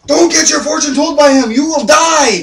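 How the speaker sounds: high, loud hammed-up warning; freaking out. [name] grabbing the old man, freaking-out mock shout. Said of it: "he's just freaking out".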